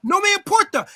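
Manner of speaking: high loud yell in Spanish. Real, not acted. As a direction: shout it over him, playfully. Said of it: "Why are you yelling?".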